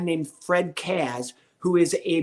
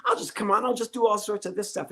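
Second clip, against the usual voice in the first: breezy, much higher self-assured thought; cocky inner voice; 5 semitones higher than usual. Retold or imitated. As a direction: sure it'll be a breeze. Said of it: "this is what I'm thinking".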